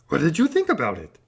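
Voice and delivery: ordinary delivery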